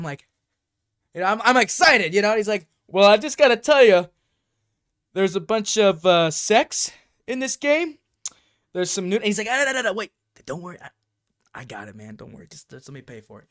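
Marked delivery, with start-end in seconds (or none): 2.9-9.2 loud, hammed-up cautionary announcement; officious clerk spelling out a warning; warning store clerk. Acting worker's warning about game. 9.5-10.1 high, loud cut-in; mock-panicked hushing protest; hasty interruption. Acting boyfriend cutting off worker. 10.4-13.5 low, very soft brush-off; hushed aside. Acted. Acting boyfriend hushing the worker.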